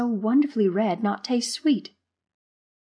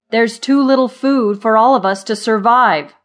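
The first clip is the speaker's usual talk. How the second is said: loud, higher irritated complaint; snappish alarm; 4 semitones higher, 10 dB louder than usual. A narrator's reading. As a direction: press the grim point loudly. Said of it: "Zimmer snapped".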